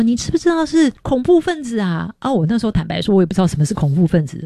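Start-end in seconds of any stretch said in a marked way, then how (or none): 0.0-2.1 pitched well up, alarmed and insistent; urgent question. Retold or imitated. Host family telling her the news, high alarmed urgency. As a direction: press her urgently about the terrorists. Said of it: "他们就跟我说".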